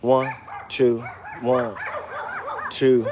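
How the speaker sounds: slowed-down count; easing each number out. Shown as a demonstration. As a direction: count it out slow and soft. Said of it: "Let's say I slowed this down".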